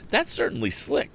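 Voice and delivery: high, admiring exclamation; exclaiming farmer. A narrator's reading. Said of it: "exclaimed Mister Blackford".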